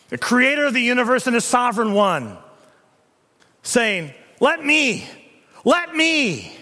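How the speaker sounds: loud, ringing pulpit delivery; thundering preacher building to a peak; preaching at full force. Acted. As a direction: proclaim it to the whole church. Preacher swelling on God's titles.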